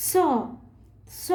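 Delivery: স said as a sharp s against the teeth; touch the teeth and hiss স as s; dental s. Shown as a demonstration. Teacher demonstrating the dental স sound. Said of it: "কীরকম?".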